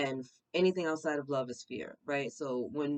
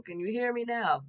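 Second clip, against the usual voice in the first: high-pitched imitation of an ad catchphrase; Verizon commercial; 8 semitones higher, 4 dB louder than usual. Reader quoting the phone commercial, high mock call-out. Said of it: "It's like the Verizon commercial".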